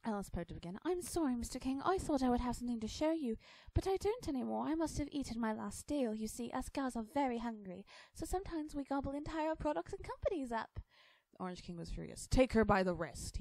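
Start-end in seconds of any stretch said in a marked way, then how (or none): 0.8-10.8 put-on high, bright-pitched girl's voice, polite and apologetic; young girl Alice. Alice's lines in the story. 12.3-13.4 loud, furious royal command; a king yelling at his guards; furious scream. Acted. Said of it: "he screamed and stomped his foot".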